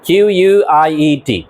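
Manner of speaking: slow letter-by-letter spelling of Q U I E T; teacher spelling out a word for the class. Shown as a demonstration. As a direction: spell each letter slowly and clearly. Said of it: "এখানে তিনটা word আছে".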